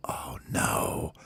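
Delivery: hushed dread. Acted. Baby bird holding his belly while others sleep, hushed dread. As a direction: whisper it so nobody wakes.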